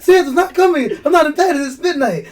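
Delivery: hammed-up kid wail, high and loud; high mock tantrum with laughter underneath; mock bawling. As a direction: cry it out loudly like a distraught child. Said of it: "I was, like, bawling".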